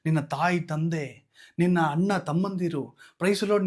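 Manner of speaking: ordinary talk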